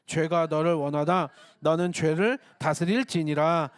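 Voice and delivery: low, measured reading of a Bible verse; scripture reading. Acted. Pastor leading the congregation in verse reading, solemn scripture reading.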